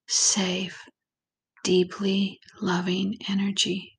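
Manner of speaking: calm, unhurried visualization voice with long pauses; guided meditation. Acted. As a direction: ease the listener gently into relaxation, lingering on key words.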